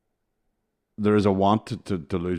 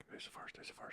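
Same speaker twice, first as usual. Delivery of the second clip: whispered; 26 dB quieter than usual. Shown as a demonstration. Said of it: "repeating question differently".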